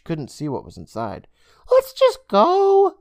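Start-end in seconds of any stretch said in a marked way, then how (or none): 1.7-2.9 put-on high boyish voice, slow and nervous. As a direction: beg to leave, kid voice. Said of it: "Day Day suggested nervously".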